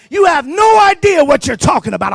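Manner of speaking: loud, high, furious denial; angry retort. Retold or imitated. Preacher voicing Peter cornered by the crowd, angry shout. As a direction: Peter snapping back as he loses his temper. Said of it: "started cursing and getting upset and said".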